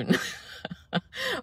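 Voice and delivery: high squeaky giggle; high laugh. Real, not acted. Laughs at own nickname.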